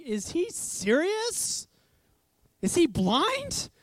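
slow, high incredulous questions; incredulous onlookers. Acted. Crowd reacting to Jesus' question, incredulous. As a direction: stretch it out in mock disbelief. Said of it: "I can imagine everyone thinking".

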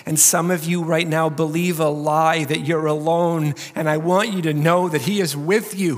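usual speaking voice

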